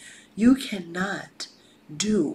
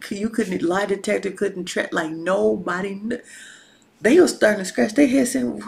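ordinary talk

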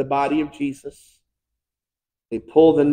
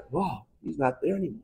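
hushed awe; 11 dB quieter than usual. Acted. Peter peering into the empty grave.

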